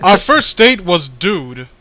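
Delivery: loud, emphatic character read; voiced character. Comic dub actor reading a character's thanks, loud. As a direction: belt out the goofy character's thanks.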